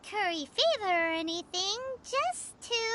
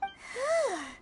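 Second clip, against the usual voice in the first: a long tired sigh; exasperated sigh. Acted. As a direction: sigh at yet another bold claim. Reacting to bold statement.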